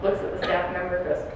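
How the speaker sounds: usual speaking voice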